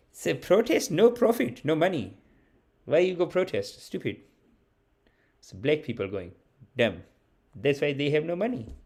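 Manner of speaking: lightly mimicked accent of a Patel uncle; dismissive elder in a Patel family's accent, mostly understated. Patel elders teaching a child about protests, mimicked uncle accent. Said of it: "I had uncles that'd be like".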